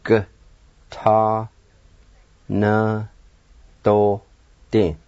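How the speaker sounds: "G, ta, na, to, di" as five separate syllables; teacher breaking up "G, ta, na, to, di", silent V after G. Shown as a demonstration. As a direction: sound out G, ta, na, to, di with long pauses. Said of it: "You just pronounce the G sound like this".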